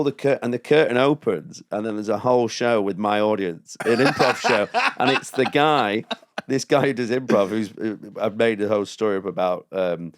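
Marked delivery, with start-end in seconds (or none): none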